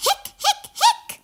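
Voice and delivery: very high rising hics; high squeaky hiccups. Acted. Hiccup sounds.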